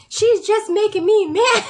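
high, loud toddler imitation; slow, high-pitched mock complaint; indignant little child. Imitating an indignant three-year-old.

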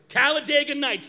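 title called out loud and proud; loud callout. Real, not acted. Pastor calling out the movie title to the congregation, loud.

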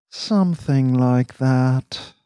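very slow, restrained reply; tight, drawn-out half agreement; through gritted teeth. A narrator's reading. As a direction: hold it back behind clenched teeth, drawing it out. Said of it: "said Dax through his teeth".

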